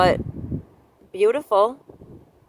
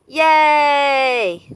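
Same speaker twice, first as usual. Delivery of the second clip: excited cheer; 4 semitones higher, 6 dB louder than usual. Real, not acted. Cheering at the ocean.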